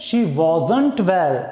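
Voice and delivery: "wasn't" spoken slowly and clearly; slow careful demo. Shown as a demonstration. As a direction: dictate it word by word. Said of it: "contraction में बोलना है तो कैसे बोलेंगे". (धीमे, साफ़ उच्चारण)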